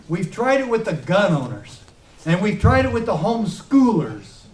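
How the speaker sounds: impassioned stump-speech delivery; fiery rally speech. Acted. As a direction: rouse the crowd, hammer each line. Rally speech at full force.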